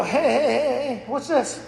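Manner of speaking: high, pointed "hey hey" at the fridge; excited call. Retold or imitated. Husband calling to wife in the living room, high and teasing.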